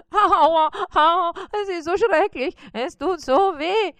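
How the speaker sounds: high moaning spider in toothache; moaning in pain. Acted. Spider moans to Bissi about his tooth, high and pained. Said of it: "stöhnte Karl-Heinz".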